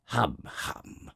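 throaty royal hum-hum; harrumphing king. A narrator's reading. King stalls before the little prince, harrumphing. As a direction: hem and haw like a monarch. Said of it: "replied the king".

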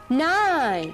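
high, clear count on the beat; count out loud. Shown as a demonstration. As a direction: call the number out loud. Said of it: "we're gonna say that one loud".

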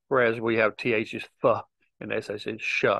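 usual speaking voice

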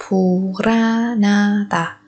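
slow, stretched "Puranada"; drawn out. Shown as a demonstration. Teacher showing how sounds link, slowly drawn out. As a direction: stretch each syllable of "Puranada".

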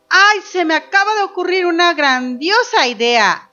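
acting out an excited girl in a story; excited child's idea. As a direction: burst out with a brand-new idea. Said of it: "pero ahora dice".

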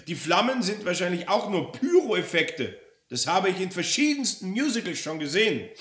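high, loud, scornful rant; outraged customer exposing a fraud; Frau Roller on a tirade. Acted. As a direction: pile up accusations indignantly.

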